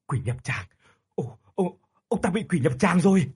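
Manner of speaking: startled, then loud terrified shout; panicked cry. A narrator's reading. Musician panics at the shaking coffin, terrified shout. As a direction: cry out in horror. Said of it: "liền hoảng hốt".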